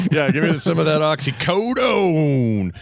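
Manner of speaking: mock drawn-out plea. Mocking pain pill craving.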